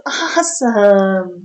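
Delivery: usual speaking voice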